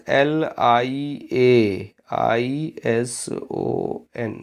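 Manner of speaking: letters spaced out one by one, slow; teacher spelling to students, slow and deliberate; slow spelling dictation. Shown as a demonstration. Teacher dictating a tricky spelling to the class, slowly letter by letter. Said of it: "इस पे focus कीजिए".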